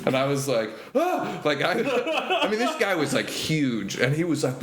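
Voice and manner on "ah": very high hammed-up scream of fright; mock scream. Comedian recalls his terror, high mock scream. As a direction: shriek like a startled kid.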